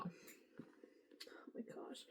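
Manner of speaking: a slow breathy whisper under the breath; slow, voiceless whisper of exasperation; whispered aside. Real, not acted. Reader stumbling over the text, slow exasperated whisper.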